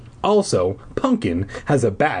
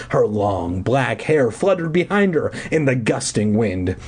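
ordinary delivery, nothing marked

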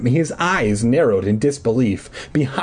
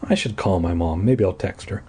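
ordinary delivery

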